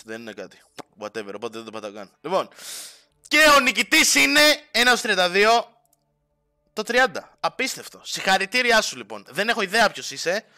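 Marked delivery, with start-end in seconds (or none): 3.3-7.4 loud, much higher game-show reveal; hyped loud prize announcement; winner announcement. Acted.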